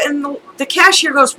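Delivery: normal talking voice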